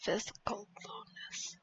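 breathy hushed read; whisper. Real, not acted. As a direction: whisper it to yourself. Reading an item name.

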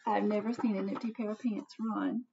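usual speaking voice

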